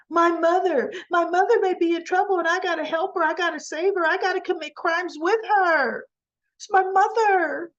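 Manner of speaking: high, wailing parody of devotion; mock outburst. Kyle protesting he must save his mother, mock high outburst. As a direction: protest high and overwrought, as a send-up.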